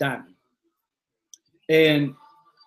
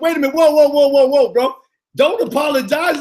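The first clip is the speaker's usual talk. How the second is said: excited protest; 6 semitones higher, 3 dB louder than usual. Retold or imitated. Reassuring brother who apologized, excitedly.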